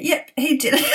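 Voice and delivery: high, certain, a touch smug; knowing accusation. Retold or imitated. Looking at Michael upstairs, high knowing verdict. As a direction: call it out like it's obvious. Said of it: "I just looked at Michael and I went".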